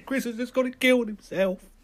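high, loud, laughing; shrieking laugh at the news. Acted. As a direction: squeal it through a laugh.

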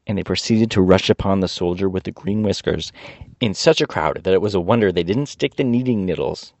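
ordinary delivery, nothing marked

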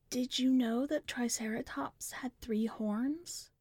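put-on soft, slow, whispery child voice; a child murmuring to herself, unsettling; little sister speaking dreamily of dinosaurs; eerie little girl.

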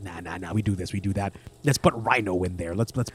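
low, dismissive exec voice; studio exec voice. Retold or imitated. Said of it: "the studio was just all like".

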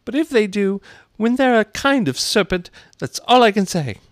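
high, loud bird voice; put-on high-pitched pigeon; dismissive pigeon. Pigeon's first take of retort.

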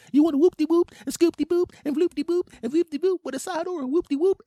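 loud, high falsetto patter; restaurant order taker. Acted. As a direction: chirp the order in squeaky falsetto.

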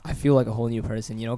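ordinary talk

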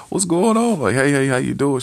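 high, loud imitated phone hello; mock eager greeting. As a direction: put on an excited, high-pitched caller. Caller's eager greeting acted out.

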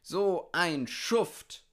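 high, loud, drawn-out outcry; indignant call. A narrator's reading. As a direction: cry out slowly in indignation. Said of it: "riefen die anderen Tiere". (empörter, lauter Ausruf)

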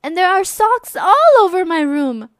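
put-on a child protesting to her mom; complaining girl.

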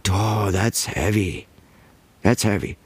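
mock guru. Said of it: "go around and go".

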